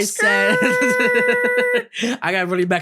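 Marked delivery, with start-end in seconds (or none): 0.0-2.2 high-pitched laugh breaking in; real laughter, very high. Real, not acted. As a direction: crack up mid-sentence. Laughing at bleep joke.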